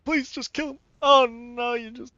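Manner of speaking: high-pitched desperate plea; high pleading. Real, not acted. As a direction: squeal it out begging. Pleading then dismay.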